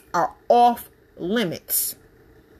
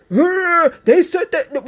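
mock offended gasp; 8 semitones higher, 6 dB louder, slower than usual. Mocking easily offended people.

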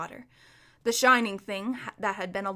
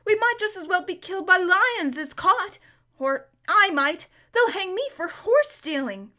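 put-on higher, louder desperate boy; frightened pleading; 8 semitones higher, 6 dB louder than usual. A narrator's reading. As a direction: protest in frightened haste. Frightened boy argues while galloping.

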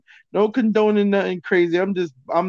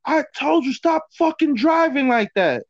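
wife chewing out her husband, voice pitched way up; retold yelling; 10 semitones higher, 3 dB louder than usual. Wife scolding husband over his driving, retold yelling. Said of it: "she was yelling at him bad".

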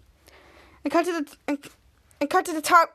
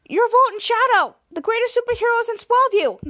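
high, loud disbelief; excited protest; 7 semitones higher, 3 dB louder than usual. Retold or imitated. Riley protesting to Volt and Shadow, high and excited. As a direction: gush about the superheroes. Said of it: "Catcher Riley said".

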